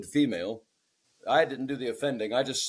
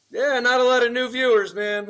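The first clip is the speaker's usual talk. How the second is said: mock heckle; 8 semitones higher, 6 dB louder than usual. Mocking the band from the stage.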